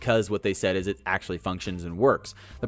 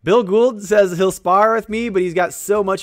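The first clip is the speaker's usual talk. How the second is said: higher, louder excited call-out; 8 semitones higher, 7 dB louder, slower than usual. Real, not acted. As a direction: fire up about the sparring offer. Excited shout to Bill Gould.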